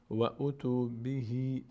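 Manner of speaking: slow "و او تو بهی" with long final i; Quran recitation. Shown as a demonstration. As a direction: recite "و او تو بهی" slowly, stretching بهی.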